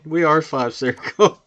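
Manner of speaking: usual speaking voice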